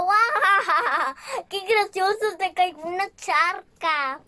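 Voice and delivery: loud, high laughing jeer; laughing mockery. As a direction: crack up while pointing at his fall. Crowd laughs at Dumbo's fall.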